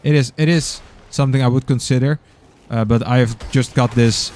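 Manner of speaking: normal talking voice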